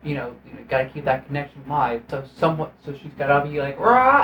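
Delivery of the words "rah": short high grunting yell; mock straining yell. Mimicking Trinity straining in the trailer, high yell. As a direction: heave it out like lifting hard.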